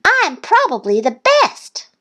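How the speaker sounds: very high duckling brag; boasting. A narrator's reading. Thistle boasting about math, high brag.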